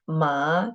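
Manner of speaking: "ma" rising like a question; second tone. Shown as a demonstration. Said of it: "second tone".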